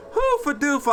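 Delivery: high, silly cartoonish squeak; goofy nonsense. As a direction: toss off the nonsense words like a cartoon.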